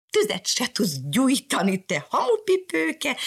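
high, slow mocking cat cry; cat taunting. Cat shouting taunts at Mariska. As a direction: jeer at her. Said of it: "kiáltott rá Mariskára".

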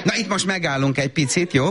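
ordinary delivery

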